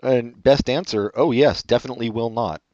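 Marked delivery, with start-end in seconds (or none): none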